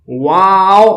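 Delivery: واو with both lips rounded, long-held; drawn-out واو closing on a round w. Shown as a demonstration. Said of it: "دونوں ہونٹوں کو گول کریں گے".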